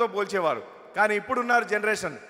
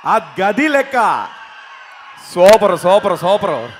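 loud, rousing crowd hype; 10 dB louder, slower than usual. Real, not acted. Comedian urging the crowd to cheer for their hero, loud. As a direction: whip the audience up. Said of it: "ఒక్కసారి hall top లేచిపోవాలి".

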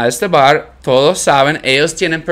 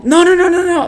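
put-on drunk refusal. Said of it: "Es como".

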